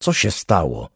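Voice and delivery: ordinary delivery, nothing marked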